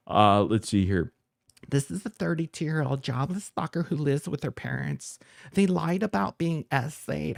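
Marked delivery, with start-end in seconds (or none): 1.7-7.4 higher, whiny mocking voice; mock reading. Reading out the rival's post to viewers in a higher mocking voice. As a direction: sneer his words back in a higher voice. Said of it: "reading the post again".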